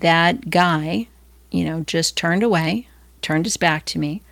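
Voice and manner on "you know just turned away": normal talking voice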